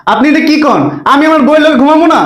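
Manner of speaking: high, indignant disbelief; incredulous protest. Acted. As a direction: object as if wronged. Husband disputes father-in-law's order.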